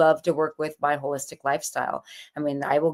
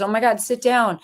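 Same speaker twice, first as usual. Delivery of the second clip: higher and a bit louder concerned exclamation; alarmed shop lady; 7 semitones higher, 4 dB louder than usual. Retold or imitated. Clerk tells the panicking woman to sit, mock alarmed. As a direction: react with sudden motherly alarm, lightly acted.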